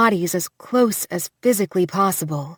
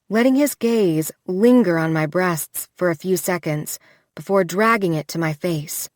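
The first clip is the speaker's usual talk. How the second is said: plain reading voice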